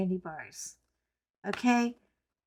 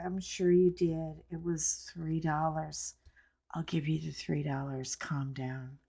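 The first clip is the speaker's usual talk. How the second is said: low, soft voice talking someone down; calming reassurance; 5 semitones lower, 8 dB quieter than usual. Retold or imitated. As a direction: soothe a panicked clerk quietly, as retold. Calming the panicking clerk.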